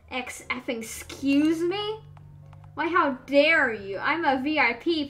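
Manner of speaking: loud, slowed, furious customer; loud indignant customer telling off the operator; indignant outrage. Acted. Customer erupts at refusing operator.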